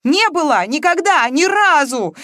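loud, high cries of denial; tearful denial. A narrator's reading. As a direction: deny it desperately, each phrase an outburst. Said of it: "уже откровенно всхлипывала Маша".

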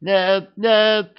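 sudden high desperate yell. A narrator's reading. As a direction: scream it out in panic. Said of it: "вдруг заорал раненый".

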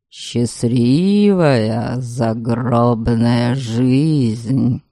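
low, slow rumbling spirit; rumbling ghost. A narrator's reading. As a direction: roar it out like a wrathful ghost. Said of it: "продолжал извращаться в угрозах дух".

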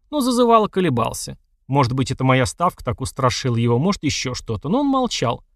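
usual speaking voice

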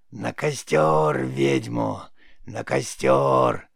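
loud, drawn-out raspy witch cry; mocking crowd shout. A narrator's reading. Witch parodies the crowd for the boy, loud drawn-out mocking shout. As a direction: parody a mob's chant in a creaky old voice. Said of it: "пародируя толпу, закричала".

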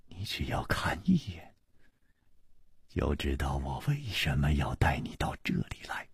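soft, low and hushed reassurance; gentle voice. A narrator's reading. Chu coaxing the girl to take one look, gently. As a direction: coax softly, almost in a whisper. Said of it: "楚留香柔声说".

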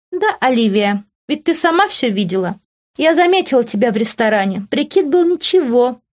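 high, taunting lilt; smug, mocking, high-pitched woman; smirking. A narrator's reading. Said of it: "усмехнулась Иви".

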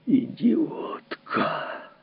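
whispered, slow, cold insult; quiet contempt. Acted. Husband hisses at his wife, quiet fury. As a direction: spit the word under your breath. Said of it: "по-прежнему тихий и ровный".

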